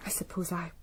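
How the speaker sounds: soft, breathy whisper to herself; half to herself, in a hush; whispered realisation. Acted. Secretary realising to the inspector she inherited, hushed whisper.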